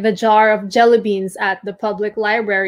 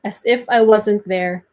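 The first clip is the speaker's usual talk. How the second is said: ordinary delivery, nothing marked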